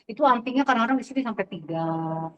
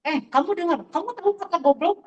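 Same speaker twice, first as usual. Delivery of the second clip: higher, sharp teacher scolding; raised-pitch reprimand to quarreling students; 7 semitones higher, 3 dB louder than usual. Retold or imitated. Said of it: "Saya langsung marah itu".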